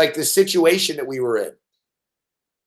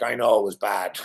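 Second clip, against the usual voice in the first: softer, deflated mock of a sad co-host; sad admission; 9 semitones lower, 5 dB quieter than usual. Imitating Cam admitting his bad intro, softly deflated. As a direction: let it drop, quietly down. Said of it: "he gets sad".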